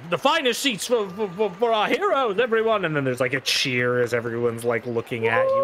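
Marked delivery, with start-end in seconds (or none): 0.1-2.8 high, loud announcement; a high, showy Doctor Malthus; grand showman. Acted. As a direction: present the heroes to the crowd. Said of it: "Malthus announcing to crowd".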